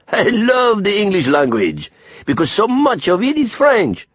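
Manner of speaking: put-on high, gleeful French-accented retort; happy French friend. A narrator's reading. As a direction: counter merrily as a theatrical Frenchman. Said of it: "Didier countered, laughing happily".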